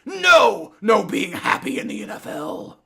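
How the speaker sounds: loud, high killjoy imitation; mock scolding officials. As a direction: shout a ridiculous ban as the league. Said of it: "they were like".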